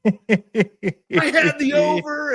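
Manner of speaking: high, loud, drawn-out brag; retold going off. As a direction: holler it slowly through amusement. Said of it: "he was going off".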